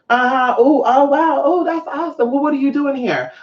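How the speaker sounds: high, cooing small talk; polite interest. Retold or imitated. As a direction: feign fascination in a lifted voice. Acts polite interest in stranger.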